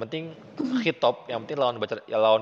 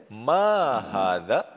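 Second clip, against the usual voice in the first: slow quiz question; much slower than usual. Shown as a demonstration. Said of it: "teacher quizzing student".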